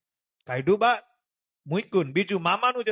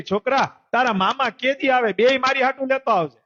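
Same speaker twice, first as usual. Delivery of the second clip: calling out loud; 7 semitones higher, 4 dB louder than usual. Acted. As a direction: shout after the boy, higher and louder. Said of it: "રાડ પાડી". (મોટેથી રાડ પાડીને બોલાવવું)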